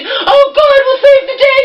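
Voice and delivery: high, shrill-loud sarcastic mimic; mocking the church friend's piety; mocking church lady. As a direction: ham up a preachy churchgoer. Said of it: "her stupid church friend".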